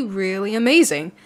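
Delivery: usual speaking voice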